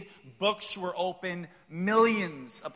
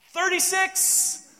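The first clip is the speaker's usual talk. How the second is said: slow, high, loud drawn-out call; a hammed-up slow shout of the count; joke golf yell; 14 semitones higher, 7 dB louder, much slower than usual. Shown as a demonstration. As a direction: announce the lost balls across the course. Joke golf yell of lost balls.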